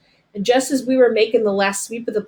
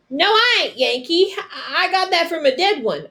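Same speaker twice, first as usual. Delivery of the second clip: high, halting frightened denial; captured boy sputtering a lie to soldiers; 9 semitones higher than usual. A narrator's reading. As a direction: stumble over the lie to save yourself. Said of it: "I sputtered".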